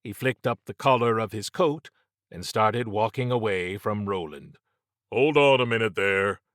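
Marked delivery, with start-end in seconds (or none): 5.1-6.4 raised voice, hailing someone; calling out. A narrator's reading. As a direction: call after a man walking away. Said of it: "Roland called out".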